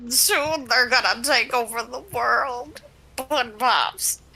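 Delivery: slow, ominous wizard doom-saying; a slow, portentous old sorcerer; ancient wizard voice. Acted. Friend does a slow wizard voice warning of tech. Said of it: "I love that voice".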